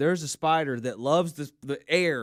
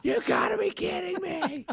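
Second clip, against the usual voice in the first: high panicked squeal; freaked-out squeal; 13 semitones higher than usual. Retold or imitated.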